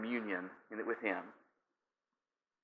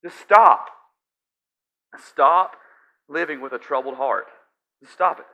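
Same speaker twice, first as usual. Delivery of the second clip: much higher and louder, commanding stop; firm loud stop; 4 semitones higher, 10 dB louder, slower than usual. Acted. Jesus ordering the anxious to stop, loud. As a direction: cut them off with a command. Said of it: "all Jesus is saying is".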